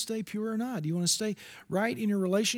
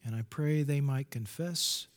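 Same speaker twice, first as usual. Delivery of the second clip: solemn prayer; 6 semitones lower, 6 dB quieter than usual. Real, not acted. Preacher asks God to give his brothers and sisters peace, solemn prayer. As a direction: plead quietly with God, phrase by phrase. Prays aloud for the congregation.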